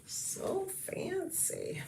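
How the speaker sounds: slow breathy whisper of so fancy; imitating the commercial elf, whispered slowly. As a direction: hush it out like the elf in the ad.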